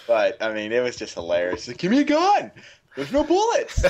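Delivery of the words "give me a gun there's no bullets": put-on much higher kid voice breaking into laughter; giggling mock kid; laughing kid imitation. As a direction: mimic the boy while cracking up. Retelling kid's lines, laughing.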